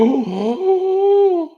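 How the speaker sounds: sharp, very high startled gasp. Retold or imitated. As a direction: gasp as if suddenly shocked. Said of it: "And they're like".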